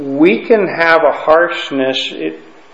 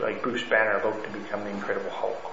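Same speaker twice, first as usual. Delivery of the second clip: ordinary talk, nothing marked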